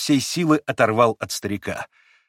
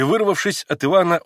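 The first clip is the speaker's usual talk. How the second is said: ordinary delivery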